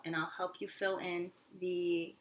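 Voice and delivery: normal talking voice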